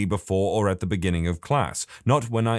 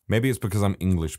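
ordinary talk

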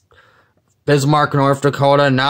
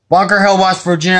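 higher and louder, thrilled; excited; 6 semitones higher, 3 dB louder, faster than usual. Real, not acted. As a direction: read it out thrilled. Rereads location after finding glitch.

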